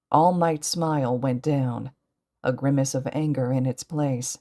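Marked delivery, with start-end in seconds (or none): none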